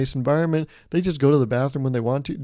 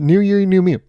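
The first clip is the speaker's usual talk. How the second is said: louder, punchy slogan; proud catchphrase; 6 semitones higher, 8 dB louder than usual. Acted. Comic pitching his slogan to the audience, proudly loud. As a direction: sell it like a tagline.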